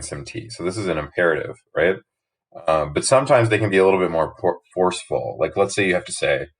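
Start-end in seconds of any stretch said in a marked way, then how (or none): none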